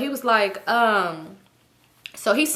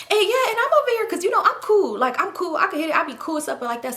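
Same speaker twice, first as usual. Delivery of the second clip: fast high bragging; 8 semitones higher than usual. Retold or imitated. Toxic quoted bragging, fast, high.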